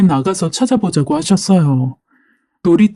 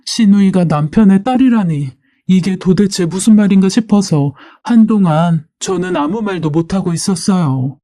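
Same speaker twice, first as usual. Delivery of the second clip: usual speaking voice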